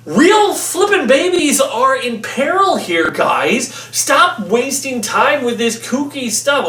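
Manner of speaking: high, drawn-out mock outrage; exasperated scolding. Reviewer scolding the filmmakers over babies in peril, exasperated. Acted scolding of the film's makers.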